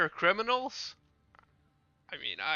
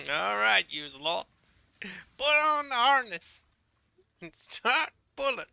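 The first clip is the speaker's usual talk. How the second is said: higher, louder barked orders; bossy commander; 4 semitones higher, 5 dB louder than usual. Pretend. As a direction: order the crew around. Bossing around game characters.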